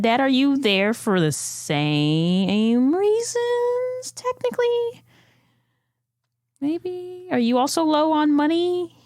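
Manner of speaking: high, drawn-out questioning; probing Daphne. Acted. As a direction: ease slowly into an awkward question, pitched high. Daphne awkwardly probes her dad.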